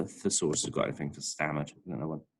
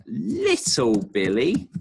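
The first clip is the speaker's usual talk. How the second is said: "Little Billy" drawn out slow, higher and louder; emphasized; 6 semitones higher, 5 dB louder, much slower than usual. Acted. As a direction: stretch out "Little Billy" in disbelief.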